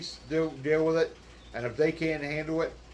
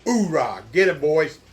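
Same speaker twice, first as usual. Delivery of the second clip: booming military battle cry; loud gung-ho soldier; 3 semitones higher, 9 dB louder than usual. Pretend. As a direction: yell it like a drill sergeant. Cheering on the Marines.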